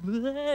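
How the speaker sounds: high garbled "bleh" noise; tongue-tied blubber. Acted. Balaam trying to curse Israel, tongue-tied babbling noise. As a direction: babble as if the word won't come out. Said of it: "He can't get it out of his mouth".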